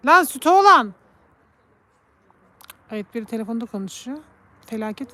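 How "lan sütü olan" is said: loud, high exasperated call. Real, not acted. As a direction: yell it at the cat. Shouting at the cat. (yüksek sesle seslenme)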